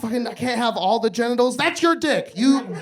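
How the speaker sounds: usual speaking voice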